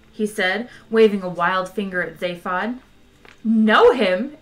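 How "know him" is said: loud, incredulous echo question; exclaiming. A narrator's reading. Ford asked if he knows Zaphod, exclaiming. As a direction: throw the question back loudly. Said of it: "exclaimed Ford".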